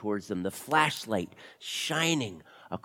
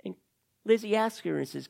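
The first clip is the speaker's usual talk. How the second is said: ordinary delivery, nothing marked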